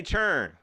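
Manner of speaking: churn said as one syllable; southern pronunciation. Shown as a demonstration. Repeating the Southern word churn to viewers, plainly. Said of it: "We say churn".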